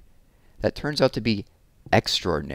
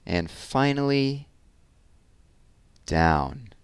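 lively picture-book read-aloud, big expressive swings; reading to children. A narrator's reading. Teacher reading a balloon story to children, animated storytelling. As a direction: read it like story time for little kids.

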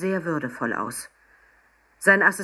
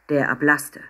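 plain reading voice

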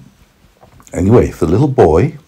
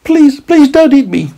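high, loud pleading carrot voice; begging carrot; 5 dB louder than usual. Acted. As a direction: beg for your life in a squeaky voice. Carrot begs boy not to eat him.

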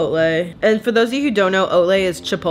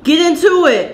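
higher, louder hype; hyped up; 7 semitones higher, 4 dB louder than usual. Shown as a demonstration. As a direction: pump it up the second time. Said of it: "repeated with emphasis".

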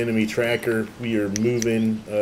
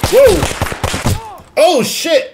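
very high shocked shout; startled yell; 9 dB louder, much slower than usual. Real, not acted. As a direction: jump out of your skin. Sudden ambush.

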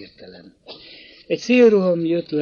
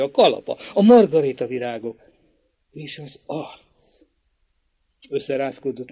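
usual speaking voice

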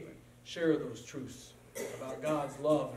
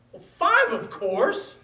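much higher, louder know-it-all answer; smug opponent; 10 semitones higher, 10 dB louder, much slower than usual. Acted. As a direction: toss it off like it's obvious. Opponent smugly answers five.

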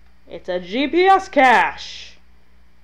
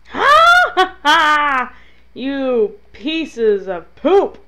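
loud, long screamed laugh into a slow insult; frustrated scream; 4 semitones higher, 7 dB louder, slower than usual. Real, not acted. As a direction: scream it out at the enemies, drawn out. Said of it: "after I go scream at a door".

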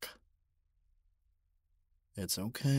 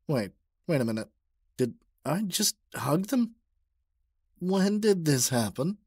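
louder, higher startled realization; flustered shock; 5 semitones higher, 6 dB louder than usual. Acted. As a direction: catch yourself in sudden panic. Realizes they are hugging.

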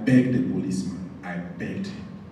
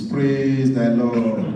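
praise the lord; 6 dB louder, much slower than usual. Real, not acted. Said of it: "closing praise".

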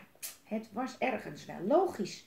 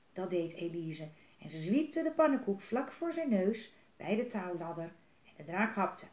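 ordinary delivery

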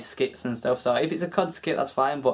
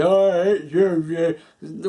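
louder, slow, hammed-up hostile jab; mock drama rant; 3 semitones higher, 5 dB louder, much slower than usual. YouTuber starting drama with another, in a hammed-up slow mock snarl. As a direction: sneer it like a fake YouTube feud. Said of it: "I don't wanna be there going".

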